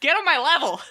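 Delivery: playful diva comeback; sassy comeback. Retold or imitated. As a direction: toss it off with attitude. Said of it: "my new favorite response".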